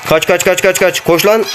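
urgent panicked run commands; frantic, high-pitched urging to flee; being chased. Real, not acted. Fleeing the chasing man in game.

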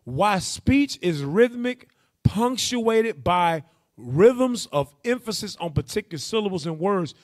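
slow, every word of "rhythmic" and "syllables" leaned on; slow, deliberate, weighty read of the passage; slow emphatic reading. Shown as a demonstration. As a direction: spell it out slowly, hitting "rhythms" and "emphasis". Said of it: "zone in on that last part".

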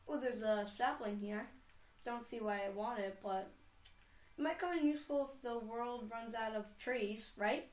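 ordinary talk

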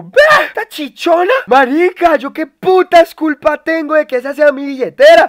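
retold outrage. Streamer reliving being scolded by the escort, loud indignant. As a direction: protest it loudly, as if wronged again.

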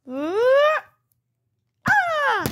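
high frustrated yelps; tantrum cries. Acted. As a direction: let out two angry kid squeals. Child's tantrum.

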